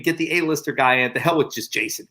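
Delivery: usual speaking voice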